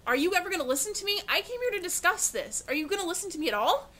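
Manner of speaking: rising frustrated demands; frustrated outburst. Acted. Guest ignored and getting upset.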